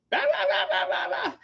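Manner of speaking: high-pitched mocking babble through a laugh; mock nagging wife. Husband mocking his wife calling after work, high laughing babble. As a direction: lampoon the wife's nonstop chatter. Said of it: "she would start".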